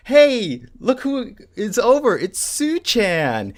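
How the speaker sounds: high, drawn-out mock-cheerful hello; excited greeting. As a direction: imitate delighted sisters greeting a guest. Sisters greeting an old friend, acted.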